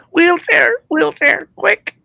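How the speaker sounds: very high, frantic squeal to escape; panicked plea. Retold or imitated. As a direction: beg to get out of there, panicking high. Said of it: "I said".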